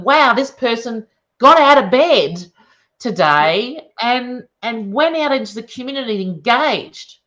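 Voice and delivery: slowed, mock-impressed praise; mock amazement. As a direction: gush over a tiny win with irony. Mocking clinical reports' low bar.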